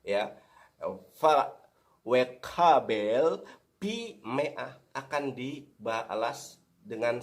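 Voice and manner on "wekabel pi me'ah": wekabel pi me'ah, very slow, each syllable drawn out; slow Hebrew reading. Shown as a demonstration. Preacher rereading the Hebrew phrase slowly for listeners. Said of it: "coba saudara lihat di dalam bahasa Ibrani ini".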